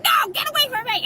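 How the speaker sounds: high, fast, loud panicked dog voice; voiced frightened dog. Beagle fending off a pushy dog, panicked yelp. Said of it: "my dog's like".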